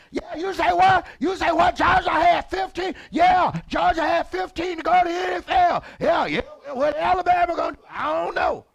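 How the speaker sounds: shouted high and loud football chatter; mock coworkers shouting about football at lunch; loud lunch talk. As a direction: yell the scores across the lunch table. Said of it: "even when they eat lunch".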